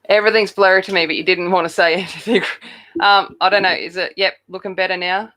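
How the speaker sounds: usual speaking voice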